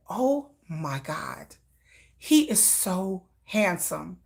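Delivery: slow breathless whisper; swooning whisper. Retold or imitated. Mother swooning over [name] on the video, slow whisper. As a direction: sigh it out, smitten.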